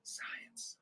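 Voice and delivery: whispered one-word punchline. Pretend. After faking facts about Nick Bailey, a whispered aside. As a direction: breathe it out as a secret.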